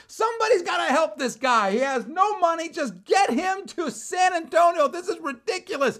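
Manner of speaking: high, loud, furious re-enacted yell; top-of-lungs yelling. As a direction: yell it out, outraged and pleading. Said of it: "I am yelling sometimes at the top of my lungs".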